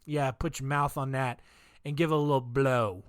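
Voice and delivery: low, soft, creepy coaxing murmur; sleazy cult leader. Retold or imitated. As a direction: purr it like a creep egging them on. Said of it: "he was just like".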